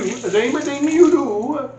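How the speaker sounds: high, slow sing-song Swedish accent. Shown as a demonstration. Cooking vlogger slipping into a Swedish accent while explaining. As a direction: lilt it like a Swedish chef. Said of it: "I went Swedish there".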